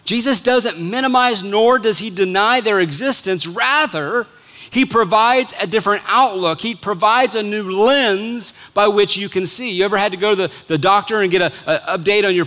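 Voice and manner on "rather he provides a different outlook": ordinary talk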